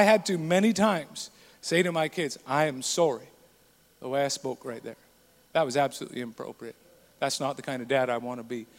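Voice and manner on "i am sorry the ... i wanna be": low, hushed contrition; earnest apology. Retold or imitated. Father saying sorry to his kids, soft and sincere. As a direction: apologize softly and low, owning the fault.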